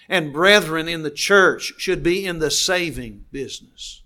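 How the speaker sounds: emphatic pulpit refrain; preaching at full force. Acted. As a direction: drive each line home harder. Preacher builds to emphatic declaration.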